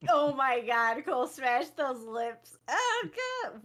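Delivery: over-the-top gushing impression, leaping high at the end; Lyric. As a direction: exclaim in a put-on streamer voice. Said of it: "I'll do my best Lyric impression".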